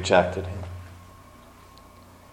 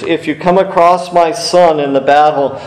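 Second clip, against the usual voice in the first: higher, louder plea to his soldiers; pleading king; 6 semitones higher, 5 dB louder than usual. Acted. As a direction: beg them urgently. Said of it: "He begged his soldiers".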